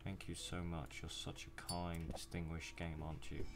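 slow, soft, dripping mock gratitude; sarcastic thanks. Gamer sarcastically thanking the stingy game for treats, slow mock sweetness. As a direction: thank the game with exaggerated fake sweetness, slowly.

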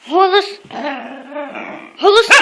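loud firm command; talking to a dog. Real, not acted. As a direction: call to the dog. Repeating bark command.

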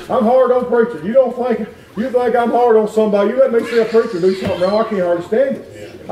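usual speaking voice

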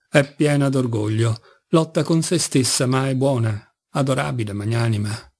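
usual speaking voice

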